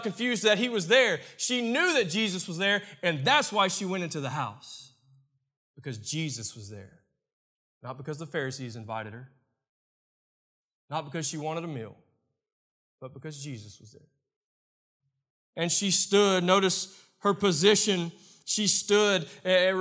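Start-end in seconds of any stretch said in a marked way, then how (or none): 5.8-14.1 hushed emphasis. Acted. Preacher hushing as he names why she came, soft. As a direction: drop way down, soft and low.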